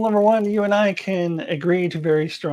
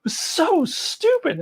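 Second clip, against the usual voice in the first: high, drawn-out mock disdain; imitating Australian girls; 13 semitones higher, slower than usual. Repeating what the exchange students said, high mocking drawl. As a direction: quote the visitors slow and high-pitched. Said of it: "I remember them just saying".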